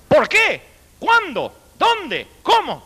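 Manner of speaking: high, sing-song questions, each falling; mock teacher quizzing the class; teacher's drill questions. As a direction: quiz them like a strict schoolmistress. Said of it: "y dijo".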